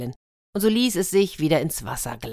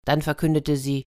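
ordinary delivery

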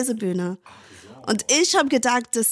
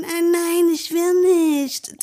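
put-on whining child; 8 semitones higher, 4 dB louder, slower than usual. Mimics unwilling children.

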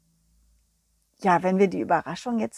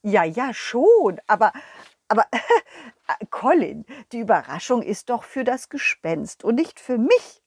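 ordinary delivery

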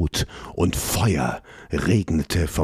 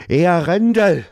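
much higher, louder desperate cry for the guard; desperate call; 12 semitones higher, 7 dB louder than usual. Acted. Prince desperately calling for his bodyguard, loud high cry. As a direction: shout for help into the empty room. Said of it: "Verzweifelt rief Elon nach seinem Leibgardist".

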